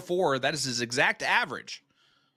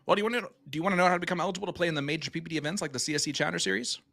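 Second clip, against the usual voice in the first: fast auctioneer patter; 3 semitones higher, 5 dB louder, faster than usual. Pretend. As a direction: rattle it off like an auctioneer. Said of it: "my auctioneer voice".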